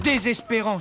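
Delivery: loud, exasperated cry of despair; a fed-up groan over the word. Acted. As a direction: throw up your hands in frustration. Exasperated sigh at the horse.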